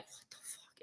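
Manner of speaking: whispered what the fuck; shocked whisper. Retold or imitated. Special teams coach reacting to the kickoff, whispered. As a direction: breathe it out in disbelief.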